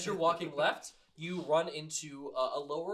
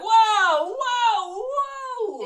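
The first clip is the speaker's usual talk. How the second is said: mock-impressed mama; 14 dB louder, much slower than usual. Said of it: "Mama's just like".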